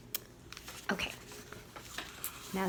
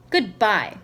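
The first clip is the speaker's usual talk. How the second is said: called out; 6 dB louder than usual. A narrator's reading. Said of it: "called Owl".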